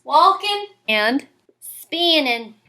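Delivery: "walking" and "spinning" said as walkin' and spinnin'; slow Southern drawl, ending in -in; Southern dropped G. Shown as a demonstration. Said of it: "so ing becomes in".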